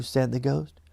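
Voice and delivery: ordinary delivery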